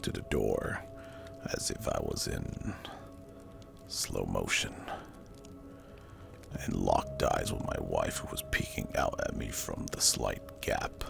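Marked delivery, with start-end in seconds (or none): none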